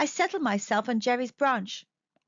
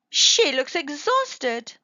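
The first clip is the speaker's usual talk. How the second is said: put-on old lady; 3 semitones higher, 6 dB louder, much slower than usual.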